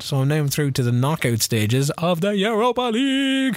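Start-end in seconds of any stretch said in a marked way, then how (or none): 2.0-3.6 rising, drawn-out grand proclamation; triumphant announcement. Pretend. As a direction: stretch it out like a big announcement.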